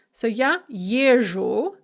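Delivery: езжу said slowly with a clear zh; slow model form. Shown as a demonstration. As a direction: stretch out езжу to show the zh sound. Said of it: "notice that the d becomes a zh".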